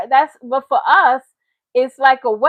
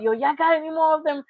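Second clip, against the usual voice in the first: quick, slightly higher fiend voice; imitating Dave Chappelle's crackhead; 4 semitones higher, faster than usual. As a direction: ask twitchy and eager, like a fiend. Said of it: "in Dave Chappelle".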